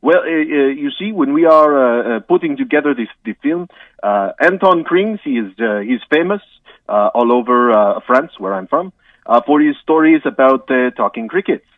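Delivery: hammed-up French accent, halting with uhs. Guest apologises to the host in a mock French accent. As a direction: play a comic Frenchman stumbling through English.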